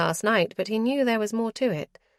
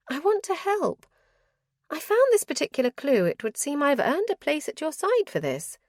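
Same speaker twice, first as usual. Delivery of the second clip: put-on much higher, eager young lady pressing her case; a bright, higher wife insisting she earned her place; higher-pitched lady, eager and determined; Clarissa's voice; 8 semitones higher than usual. Clarissa pleads to come along.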